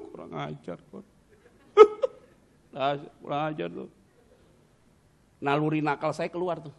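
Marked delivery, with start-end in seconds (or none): 0.1-3.9 mock sobbing. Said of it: "Nangis berhenti".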